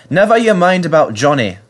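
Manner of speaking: high, jovial brush-off; laughing. A narrator's reading. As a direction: cut in with good-humoured amusement, no real laugh. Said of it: "laughed the driver".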